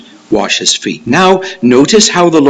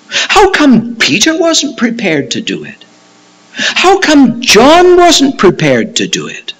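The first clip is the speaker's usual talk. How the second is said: pointed question; 12 semitones higher than usual. Acted. Poses pointed rhetorical questions.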